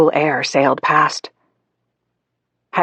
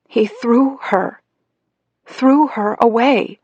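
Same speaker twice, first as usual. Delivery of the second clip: slow, higher, stunned; hurt disbelief; 8 semitones higher, slower than usual. A narrator's reading. Child realizing Daddy threw her, slow hurt. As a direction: let it sink in slowly.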